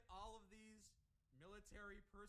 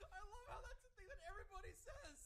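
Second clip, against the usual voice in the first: high, loud, talking through laughter; laughing hard; 4 dB louder than usual. Real, not acted. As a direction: crack up while getting the words out. Cracking up at the story.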